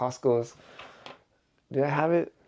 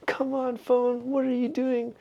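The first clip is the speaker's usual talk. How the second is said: mock yelling; 12 semitones higher than usual. Driver in the car fed up with his phone, mock yelling. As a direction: play at yelling in a strained, raised pitch. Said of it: "a guy in the car yelling at his phone".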